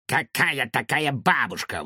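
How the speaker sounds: plain reading voice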